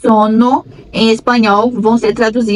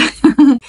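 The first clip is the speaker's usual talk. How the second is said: short laugh; 4 semitones higher than usual. Real, not acted. End of lesson chat.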